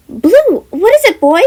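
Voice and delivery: high, loud urgent call; alarmed call. Acted. Jem calls her bear.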